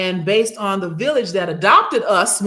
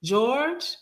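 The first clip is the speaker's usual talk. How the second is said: a grandmother calling George, pitched higher; higher questioning call of a grandfather's name; 6 semitones higher than usual. Retold or imitated. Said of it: "I heard my grandmother's voice call my grandfather's name".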